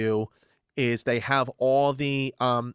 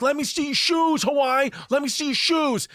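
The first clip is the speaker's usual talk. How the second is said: high-pitched imitation of a yelling crowd; screaming heckler; 10 semitones higher than usual. Men yelling at Miss Hawaii to show shoes, screaming. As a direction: holler it up at someone passing on a float. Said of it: "They were, like, screaming at her".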